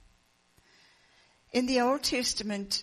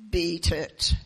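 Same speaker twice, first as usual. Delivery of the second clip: a touch louder, gruff command; mild yell; 4 semitones lower, 5 dB louder than usual. Acted. Giant father warning off the grade eight kids, mildly loud. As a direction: warn the big kids off, firm but not full volume. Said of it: "He looked at the big kids and yelled".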